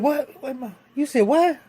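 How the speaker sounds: very high-pitched, incredulous outburst; shocked disbelief. Retold or imitated. Man hearing she took millions from him, shocked. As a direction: sputter the question in high disbelief. Acted shock at news of money taken.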